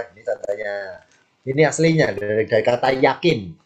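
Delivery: ordinary talk, nothing marked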